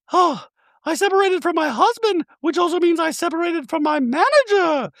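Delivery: very high-pitched mock-chirpy caricature; ditzy reality-star send-up, far above normal pitch; Dorit. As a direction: squeal it out in a silly falsetto. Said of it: "And she's like".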